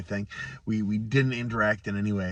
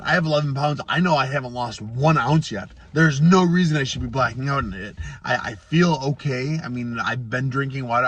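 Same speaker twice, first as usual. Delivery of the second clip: normal talking voice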